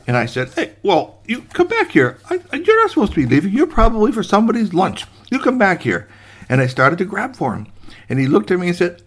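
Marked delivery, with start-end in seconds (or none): 0.5-6.1 high, hurried protest; flustered ordering. Acted. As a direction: stammer orders at an escaping cookie. Gardener orders cookie back.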